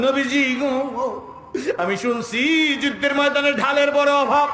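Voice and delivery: impassioned appeal. Acted. Mother telling the Prophet shields are scarce, impassioned appeal. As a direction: sing out the plea.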